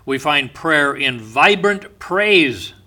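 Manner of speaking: normal talking voice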